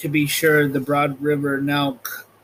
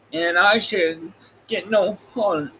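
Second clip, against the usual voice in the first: Tin Woodman voice; 4 semitones higher than usual. Acted. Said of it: "said the Tin Woodman".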